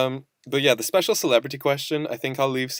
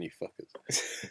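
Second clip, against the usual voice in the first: whispered; 5 semitones lower, 13 dB quieter, much slower than usual. Pretend. Mock curse at nut-filled food.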